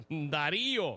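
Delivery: "Darío" alargado, con la í muy marcada; acento en la í. Shown as a demonstration. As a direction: estira la í de "Darío" al corregir. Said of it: "No tiene acento en la I". ("Darío" con acento prosódico en la í, muy lento)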